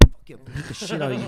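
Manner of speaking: loud laughing mock threat; joking threat, laughing. Joking to friends about a girlfriend, loud and laughing. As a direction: blurt it out loud through a laugh.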